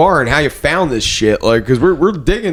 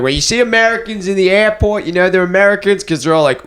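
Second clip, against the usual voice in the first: put-on higher-pitched Australian accent; Jim Jeffries; 5 semitones higher than usual. Retelling a comedian's airport bit, Australian accent. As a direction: tell it in an Aussie comedian's accent. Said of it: "You do a good Australian accent".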